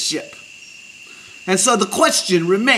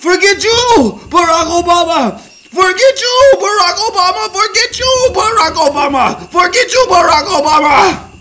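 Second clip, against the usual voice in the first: higher, louder, repeated furious yells; furious shouting; 6 dB louder than usual. Acted. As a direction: scream the insults at the top of your voice. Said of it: "A little heated, are we?".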